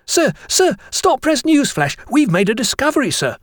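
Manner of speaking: high-pitched breaking-news schoolboy cry; excited calling. A narrator's reading. Jennings runs up with a discovery, high excited calling. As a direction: call out thrilled to your teacher. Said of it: "he called".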